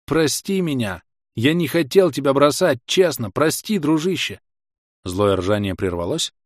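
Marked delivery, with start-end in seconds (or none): 0.1-4.4 raised, earnest begging call; pleading cry. A narrator's reading. Hero begging his horse to forgive him, raised plea. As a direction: call out sincerely, asking forgiveness. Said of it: "крикнул я".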